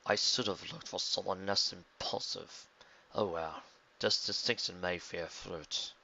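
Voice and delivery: low, soft, slow villain voice; cold calculating schemer; scheming villain. A narrator's reading. Villain Vildrax speaks alone.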